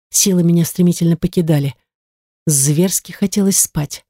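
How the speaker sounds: usual speaking voice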